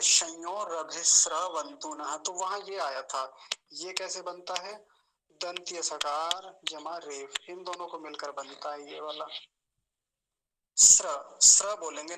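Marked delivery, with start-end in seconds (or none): 0.0-2.2 slow, clear Sanskrit recitation; syllable by syllable, slowly; careful recitation. Shown as a demonstration. Teacher recites the mantra slowly for students. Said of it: "संध्या के मंत्र जब पढ़ रहे थे". 10.8-12.2 स्र said loud as dental s with r; loud clear dental स्र, not श्र; correct sra. Shown as a demonstration. Teacher demonstrates the right sound, loud and clear. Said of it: "ना कि श्र".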